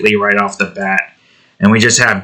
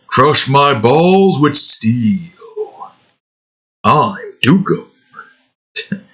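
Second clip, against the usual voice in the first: funny video quote; 3 semitones higher, much slower than usual. Retold or imitated. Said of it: "where it's just like".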